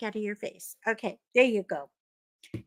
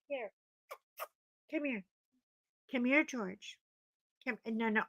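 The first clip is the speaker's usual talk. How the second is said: much softer coaxing; hush-voiced coax to a pet; softly calling the dog over to her; 13 dB quieter than usual. Real, not acted. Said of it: "coaxing the dog softly".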